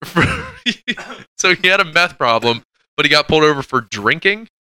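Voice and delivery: can't believe the story he's hearing; incredulous disbelief. Real, not acted. Host incredulous, laughing.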